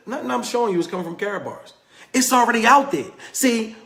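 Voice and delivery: ordinary talk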